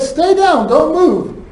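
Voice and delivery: mock command, pitched well up; retold command. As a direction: imitate ordering someone to stay put. Retelling a command to victim.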